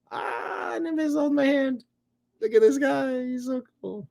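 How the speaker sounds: very high, soft gushing; cooing pet talk. Real, not acted. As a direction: coo adoringly at the cat in a tiny high voice. Cooing over the pet cat.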